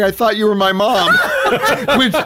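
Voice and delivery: laughing. Real, not acted. Laughing at own childhood blunder.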